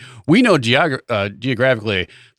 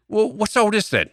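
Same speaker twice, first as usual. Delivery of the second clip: higher, cartoon British bobby; hammy British "what's all this then"; British accent; 8 semitones higher than usual. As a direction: put on a British policeman's voice. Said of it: "you do your British racism".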